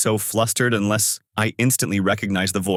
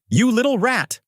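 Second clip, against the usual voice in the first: much higher, angry cry; mild narrated yell, pitched way up; 13 semitones higher than usual. A narrator's reading. Manager cursing the inspector down the phone, angry yell. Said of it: "he yells".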